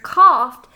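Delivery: coughed said loud and firm; stress on coughed. Shown as a demonstration. Teacher to class, stressing coughed loud and firm. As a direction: lean hard on the word coughed. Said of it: "The author has used this word".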